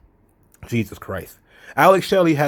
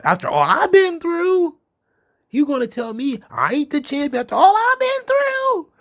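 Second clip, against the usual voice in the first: much higher, nasal-free mock whine in a put-on voice; mocking whiny Kenny. Podcaster mocks Kenny Omega's promo in a high whiny put-on voice. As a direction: parody a self-pitying wrestler, pitched way up. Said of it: "how this guy is gonna".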